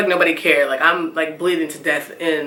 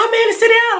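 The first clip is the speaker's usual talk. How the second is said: higher, louder panicked yell; mock yelling; 4 dB louder than usual. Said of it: "everyone's screaming".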